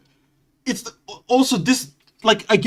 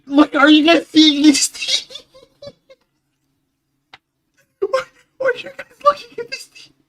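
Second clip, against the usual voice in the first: laughing; 6 dB louder than usual. Real, not acted. Laughing at chat messages.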